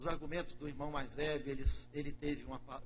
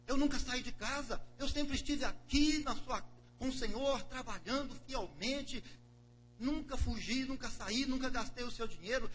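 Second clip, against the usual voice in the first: higher and louder, aggrieved; resentful older brother; 9 semitones higher, 8 dB louder than usual. Acted. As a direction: pour out the grievance to father. Broer klaagt bij vader.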